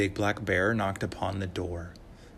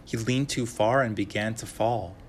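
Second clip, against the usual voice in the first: ordinary delivery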